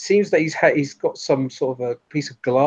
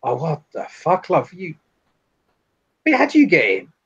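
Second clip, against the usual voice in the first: mock-startled challenge, rising higher; startled lead figure; 5 semitones higher than usual. Lead figure recognises the intruder, startled. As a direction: confront someone who shouldn't be here.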